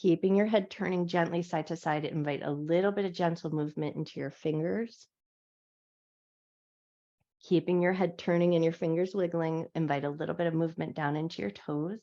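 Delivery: calm, gentle guiding voice through each stretch; guided yoga instruction. Acted. Yoga teacher guiding her class through a wake-up stretch, calm guided voice.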